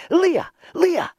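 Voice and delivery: high-pitched calling; high, urgent cry. A narrator's reading. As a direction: call eagerly at a neighbour's door. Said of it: "they cried".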